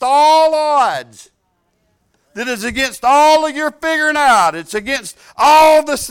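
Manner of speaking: full-force preacher; preaching. Acted. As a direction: preach it to the congregation. Preaching climax.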